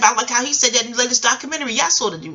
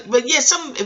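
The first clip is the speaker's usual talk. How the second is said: usual speaking voice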